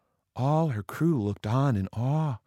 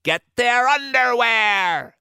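very high, loud battle cry; woman pirate captain's shriek; calling to crew; 13 semitones higher, 4 dB louder, slower than usual. A narrator's reading. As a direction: holler the order across deck. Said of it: "called to her crew".

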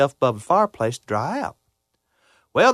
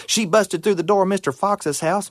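usual speaking voice

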